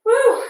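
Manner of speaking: high whoop at the finish; relieved woo. Real, not acted. Instructor whooping after finishing the set, high.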